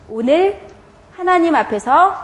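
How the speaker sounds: teacher leaning on 오늘 to set up her point; stress on 오늘. Shown as a demonstration. 교사가 힘주어 강조하는 오늘. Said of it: "오늘입니다".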